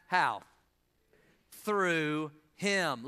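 usual speaking voice

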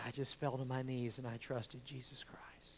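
soft whisper of a tearful confession. Retold or imitated. Sister-in-law telling how she got saved, whispered. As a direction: breathe it out as a hushed whisper.

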